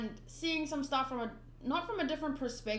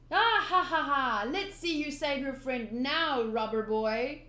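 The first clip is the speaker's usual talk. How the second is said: villain's gloating laugh; 4 dB louder than usual. Acted. Villain taunting rubber boy, loud gloating laugh. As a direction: gloat over the captured friend.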